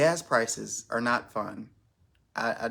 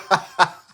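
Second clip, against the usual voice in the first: high, loud burst of laughter; real belly laugh; 7 semitones higher, 12 dB louder than usual. Real, not acted. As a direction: crack up at your own joke. Cracks up at own remark.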